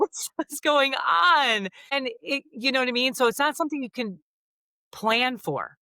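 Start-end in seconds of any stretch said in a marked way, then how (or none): 0.0-1.7 slow, high, startled asking; a confused plea, drawn out; bewildered. Retold or imitated. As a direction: caught off guard by sudden tears. Retelling sudden crying in car.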